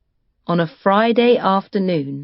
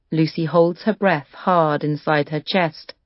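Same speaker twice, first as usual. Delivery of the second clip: plain reading voice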